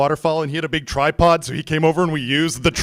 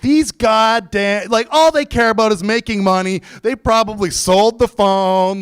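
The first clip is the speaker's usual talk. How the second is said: so pissed; 6 semitones higher than usual. Retold or imitated. Customer ranting about car service keeping his phone, so pissed. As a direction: rail against them in exasperation. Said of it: "I'm just getting so pissed".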